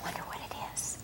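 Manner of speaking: hushed suspense whisper. Acted. Whispering suspense to a child. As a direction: breathe it like a secret.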